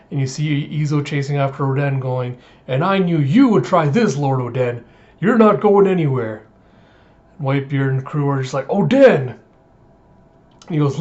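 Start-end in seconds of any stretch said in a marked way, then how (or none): 2.7-6.5 scolding shout. Retold or imitated. Retainer chasing his runaway lord, scolding loudly. Izo chasing after Oden, calling. 8.7-9.3 loud, high cry of the name; exasperated shout. Retold or imitated. Crew shouting at the stowaway, exasperated. Whole crew exclaims at Oden.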